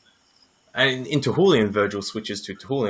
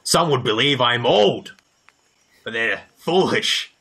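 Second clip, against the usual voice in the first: ogreish; 3 semitones higher, 4 dB louder than usual. Acted. Ogre boasting to the party about his strength, lightly ogreish. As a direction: gloat about his form like a mild ogre. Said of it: "he sounds a little bit ogreish".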